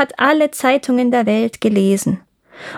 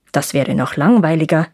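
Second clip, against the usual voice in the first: usual speaking voice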